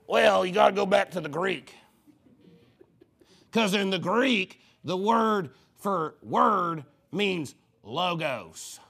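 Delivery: slow, drawn-out pompous scholar imitation; mock-learned, word by ponderous word; mocking the explainer. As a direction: drag it out like a phony expert. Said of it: "Here's his explanation".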